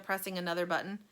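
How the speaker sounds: usual speaking voice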